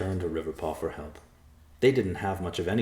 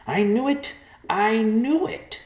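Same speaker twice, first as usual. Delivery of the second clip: much higher, louder, gleeful cry; gloating vulture; 6 dB louder, slower than usual. Acted. Vulture gloating over the seekers, high gleeful cry. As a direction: crow it triumphantly.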